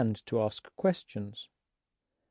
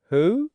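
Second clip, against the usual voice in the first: "Who?" rising, louder and higher; 7 semitones higher, 6 dB louder than usual. Shown as a demonstration. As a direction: call out "Who?" with an upward sweep. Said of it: "Now say".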